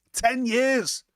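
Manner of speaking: disbelieving repeat; incredulous exclaim. Real, not acted. Vlogger repeating the figure to viewers in disbelief.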